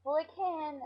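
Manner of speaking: usual speaking voice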